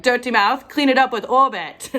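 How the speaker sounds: mock British ad voice; British accent. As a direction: Ohio comic's best British accent. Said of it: "That is my best British accent".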